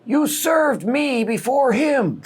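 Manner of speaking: put-on high, slow old man voice pointing blame; tattling old man. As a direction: pitch way up and tell on someone slowly. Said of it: "but then he says".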